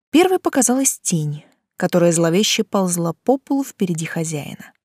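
ordinary delivery, nothing marked

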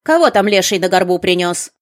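put-on loud, cross old crone; grumpy witch. A narrator's reading. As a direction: yell like an irritated witch. Angry witch at door.

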